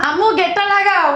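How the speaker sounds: high-pitched call through the house. Acted. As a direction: call out to mother to come. Calls mother to shut gate.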